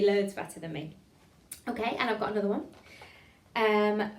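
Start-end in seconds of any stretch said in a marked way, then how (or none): none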